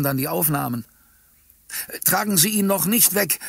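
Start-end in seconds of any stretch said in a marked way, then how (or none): 2.0-3.4 higher-pitched urgent plea; pleading. A narrator's reading. Bystanders begging the ambulance team to wait, pleading. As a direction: beg them to hold off. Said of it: "baten die Umstehenden".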